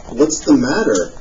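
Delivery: ordinary delivery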